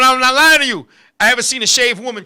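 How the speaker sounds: ordinary talk, nothing marked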